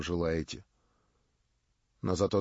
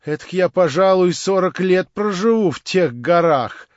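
higher, louder, laughing tone; Marya, mocking; 8 semitones higher, 10 dB louder than usual. A narrator's reading. Marya laughs off his proposal, higher and louder. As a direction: scoff at him in her shrill girlish voice. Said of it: "Она рассмеялась".

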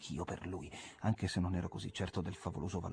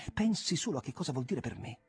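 higher, eager plea; excited; 6 semitones higher than usual. A narrator's reading. Jim to his friend, higher and eager. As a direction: make him feel your joy.

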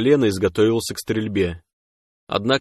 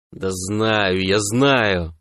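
irritated; much slower than usual. A narrator's reading. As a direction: wave the nagging away with annoyance. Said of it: "раздражённо отмахнулся Сом". (раздражённо, отмахиваясь)

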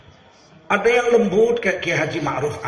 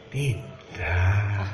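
low, quiet, gentle "tidak"; a mild no from a gentle cleric; soft low refusal; 11 semitones lower, 7 dB quieter than usual. Retold or imitated. Imitating Kiai Ma'ruf saying no to a request, soft and low.

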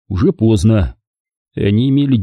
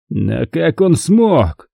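hoarse mutter; 6 semitones higher, much slower than usual. A narrator's reading. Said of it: "хрипло пробормотал Стану".

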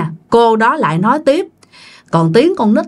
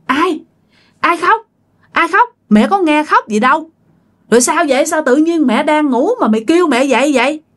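higher, groggy startled questions; mother jolted awake, confused and higher; 7 semitones higher, faster than usual. Retold or imitated. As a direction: woken mother asking who is crying. Mother woken at 2am.

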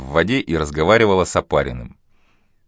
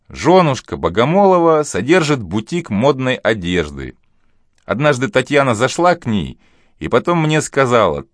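lightly raised, lively man's voice; male character voice; 6 semitones higher, 3 dB louder than usual. Acted. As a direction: tell it knowingly, a bit raised. Ivanov voiced in the story.

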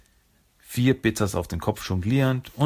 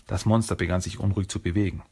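ordinary delivery, nothing marked

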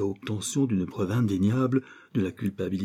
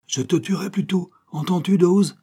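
high, loud, furious threat; threatening roar; 11 semitones higher, 6 dB louder than usual. A narrator's reading. Man in pyjamas threatening to kill Dawes, furious. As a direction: make your partner shudder with it. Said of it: "gronda l'homme au pyjama".

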